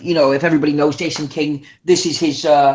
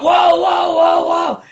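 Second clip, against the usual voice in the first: shouted interruption; 10 semitones higher, much slower than usual. Retold or imitated. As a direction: storm in to cut him off. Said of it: "And I come out".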